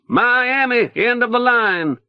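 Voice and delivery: high, ringing conductor's call; announcing. A narrator's reading. Leo announces the end of the trip to the Haitians, high conductor's call. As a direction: sing out the last stop. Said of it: "Leo announces".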